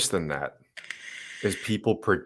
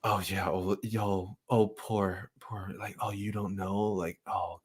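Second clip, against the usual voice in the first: hushed mock pity, much softer; condescending; 8 dB quieter than usual. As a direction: imitate someone talking down with fake sympathy. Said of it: "you hear this weird condescending".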